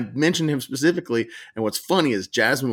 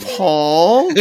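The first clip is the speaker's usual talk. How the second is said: higher, louder accusing Paul with a rise; mock suspicious; 7 semitones higher, 7 dB louder than usual. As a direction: call him out through a laugh. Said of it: "repeats the accusing name".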